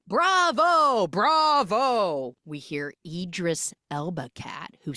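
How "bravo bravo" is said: loud, high, drawn-out bravos; theatrical cheering. Retold or imitated. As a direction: cheer like an enraptured audience. Acted cheer after the performance.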